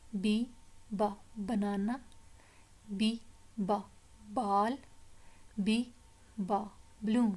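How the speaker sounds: "B", short "ba", then "banana", set apart; letter, sound, word. Shown as a demonstration. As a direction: drill "B, ba, balloon" firmly with pauses. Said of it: "हमने sound भी पढ़ना है". ("B, ba, ball" अक्षर, ध्वनि, शब्द अलग-अलग)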